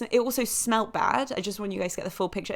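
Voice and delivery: normal talking voice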